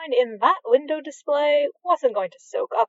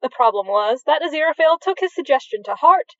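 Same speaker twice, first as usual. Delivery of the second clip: ordinary delivery, nothing marked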